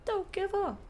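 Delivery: high echo of the game voice; imitating game announcer. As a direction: parrot the cheery announcer. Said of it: "game voice line just played".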